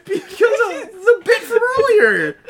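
high, loud, slow wheeze of words; really cracking up; laughing hard. Real, not acted. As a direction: squeal it through helpless laughter. Helpless laughter explaining the bit.

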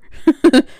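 short, high giggle; amused laugh. Real, not acted. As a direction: giggle at your own silly suggestion. Amused by own nap suggestions.

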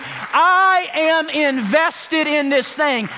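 slow, drawn-out, every word leaned on; emphatic slow declaration. Real, not acted. Preacher to congregation, slow emphatic vow. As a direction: plant your feet and declare it. Pastor declaring commitment to marriage.

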